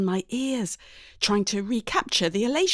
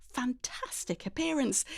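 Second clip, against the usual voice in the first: usual speaking voice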